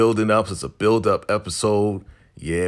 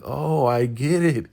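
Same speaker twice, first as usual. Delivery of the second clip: coy; 5 semitones higher than usual. Retold or imitated. Her acting like she doesn't really know, coy. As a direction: play innocent, voice lifted. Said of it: "She's acting real coy".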